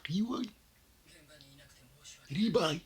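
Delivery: high, loud call of a name; excited calling out. Real, not acted. Fan greets his favourite character on screen with a high excited call. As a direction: call out to your favourite, thrilled.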